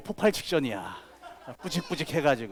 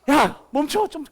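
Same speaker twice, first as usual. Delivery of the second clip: high, loud call to wait; left-behind animal; 10 semitones higher, 4 dB louder than usual. Retold or imitated. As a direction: call after the fast kangaroo. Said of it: "캥거루가 너무 빨라".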